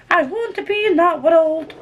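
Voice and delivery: high-pitched, yearning wail; yearning plea. Acted. Reader begging to return to the book world, yearning. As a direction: plead to be let back in.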